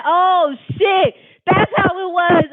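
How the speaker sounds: loud, high burst of realization; sudden realization. Real, not acted. Storyteller suddenly remembers, loud and high realization. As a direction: it just clicked, blurt it out.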